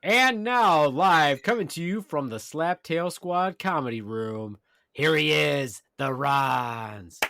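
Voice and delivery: big hype emcee intro; comedy club announcer. Acted.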